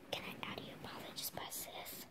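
hushed whisper to dad behind the camera. Real, not acted. Kid whispering to her dad while he films. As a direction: whisper so the dogs don't hear. Kid quietly sets up phone.

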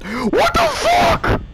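a high, loud startled yell; panicked shriek. Real, not acted. Player yelling as the animatronic appears at the door. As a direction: scream it out, caught off guard. Jumpscare panic in horror game.

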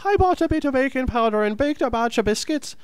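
very high, sing-song fake Irish; badly faked high-pitched Irish recitation; bad Irish accent. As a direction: recite the tongue twister in a squeaky mock-Irish lilt. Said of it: "Your Irish accent is completely a misrepresentation".

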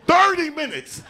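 high, loud "Thirty" in disbelief; shocked. Acted. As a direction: act amazed at the number. Stunned exclamation.

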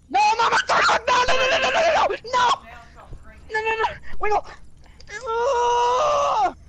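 high, loud panicked screams of no; panicked screaming. Real, not acted. Getting caught in game.

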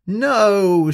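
loud, high cry of protest; shout. Acted. Speedy seeing Steady about to win, shouting loud and high. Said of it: "shouted Speedy".

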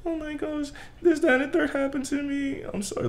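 high, soft imitation of a girl; high-pitched mock girl; girly voice. Girl calling after trouble.

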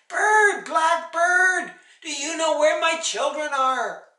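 high, urgent shout up at a bird; frantic mother calling out; yelling. A narrator's reading. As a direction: holler desperately for the children's whereabouts. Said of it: "she yelled".

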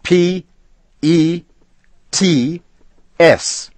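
each letter loud and high, one by one; spelled out. A narrator's reading. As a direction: spell it out slowly in anger.

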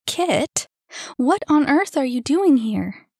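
high, loud startled question; surprised and a little irritated. A narrator's reading. Surprised at friend appearing.

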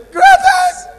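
very high squeaky call; mock girl squealing the school cry; girly squeal. Girl's squealed reply.

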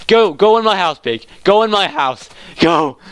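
high, eager coaxing; excited urging. Real, not acted. Player herding a pig into the house, excited urging. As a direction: egg it on, pitched high.

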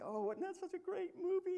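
high, sugary gushing voice; gushing wife. Retold or imitated. Girlfriend gushing to her date about the movie. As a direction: gush over the movie like a smitten girlfriend.

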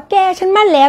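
put-on high-pitched gushing to a girlfriend; acted excited announcement; excitedly telling a friend. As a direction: share big news with a close friend, pitch jumping up. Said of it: "เราเล่าให้เพื่อนฟังว่า".

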